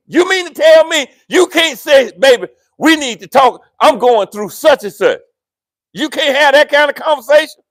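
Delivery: loud, high preaching shout; fiery preaching. Acted. Pastor urging husbands, shouting sermon. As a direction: preach it out hard.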